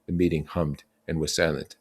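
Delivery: usual speaking voice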